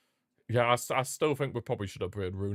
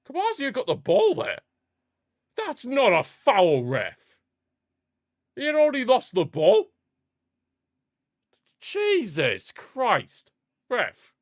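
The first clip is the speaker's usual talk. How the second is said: higher, louder protest at the ref; outraged protest; 11 semitones higher, 6 dB louder, slower than usual. Real, not acted. Streamer yelling at the referee over a foul, outraged. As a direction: yell at the ref that it's no foul.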